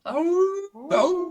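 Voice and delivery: high, long howling oh; wolf howl. Shown as a demonstration. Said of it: "like, a wolf howl".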